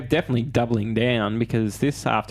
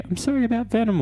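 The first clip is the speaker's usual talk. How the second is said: much higher, mock girlish line reading; high teasing imitation of a love interest; mock Michelle Williams; 13 semitones higher than usual. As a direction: squeak it out like a sweet movie girlfriend. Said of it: "what did she say?".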